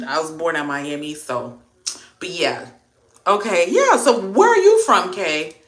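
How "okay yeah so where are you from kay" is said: high, loud eager ask; excited question. Real, not acted. Asking a live viewer where she is from, eagerly. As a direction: perk up and ask keenly.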